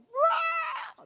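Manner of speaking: very high imitated child's wail; child's crying wail. Father imitating his child crying downstairs, high wail. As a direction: mimic a small child's dramatic bawl. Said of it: "anak saya nangis di bawah".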